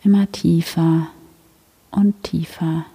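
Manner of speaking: sleep story narrator. Acted. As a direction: ease the listener gently into stillness. Leads a silent heart meditation.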